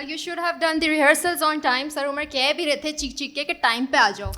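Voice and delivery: usual speaking voice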